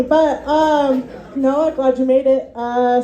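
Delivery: ordinary delivery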